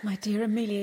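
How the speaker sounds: ordinary talk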